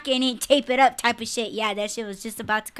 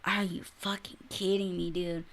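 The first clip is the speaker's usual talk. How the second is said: much softer, lower incredulous aside; muttered disbelief; 5 semitones lower, 10 dB quieter than usual. Real, not acted. Reacting to gushing comments, low soft disbelief. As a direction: say it under your breath, stunned. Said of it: "I was like".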